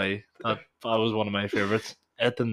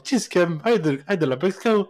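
innocent workmate; 8 semitones higher, 4 dB louder than usual. Retold or imitated. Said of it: "He's like".

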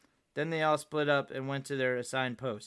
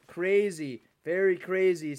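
louder, higher sarcastic echo; mock the idea with punched-up words; pointed, raised-pitch sarcasm; 4 semitones higher, 5 dB louder, slower than usual. A narrator's reading. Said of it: "said Frosty sarcastically".

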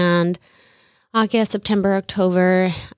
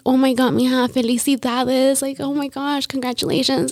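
imitating a delighted mother, higher pitched; happy mom; 6 semitones higher than usual. Mom hears daughter is pregnant, imitated happy congratulations. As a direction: gush congratulations in a high retold voice. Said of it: "my mom was so happy".

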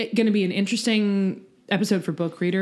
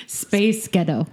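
ordinary talk, nothing marked

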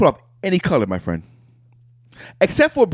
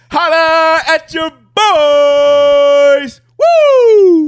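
hype shout; 7 dB louder, much slower than usual. Acted. Hype call at intro.

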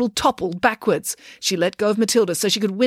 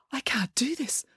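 whispered reluctant mutter; barely voiced, very soft; 5 semitones higher, 9 dB quieter than usual. A narrator's reading. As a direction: mutter it under your breath. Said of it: "muttered Matilda".

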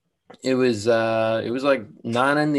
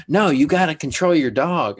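higher, louder retort from the bossy beach guy; mock yell; 6 semitones higher, 6 dB louder than usual. Angry stranger scolding him about his dog, imitated shout. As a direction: snap it back like an indignant busybody. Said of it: "he was just yelling at me".

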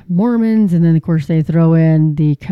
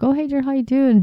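a light, higher cheery hello; imitating cheery greeting; 8 semitones higher than usual. Greeting Daryl at the gas station, lightly voiced cheery hello. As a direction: greet an old friend brightly, lightly voiced. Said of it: "I was like".

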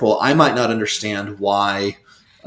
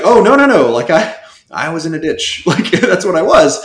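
emphatic denial; 6 semitones higher, 4 dB louder than usual. Retold or imitated. Denying to students, laughing.

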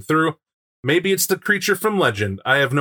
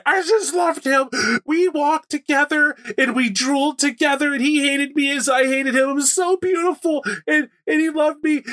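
mock heartbroken wail; 9 semitones higher than usual. Lishta mourning her lost love to the pack, in a high hammed-up wail. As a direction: wail it like a lovesick werewolf girl. Grieving NPC wailing her loss.

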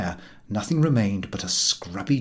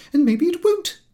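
high, louder lady's retort; rising key; 3 dB louder than usual. Acted. Angry mother retorts to the chief, voice rising high. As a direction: snap it back with the pitch climbing. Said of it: "her key continuing to rise".

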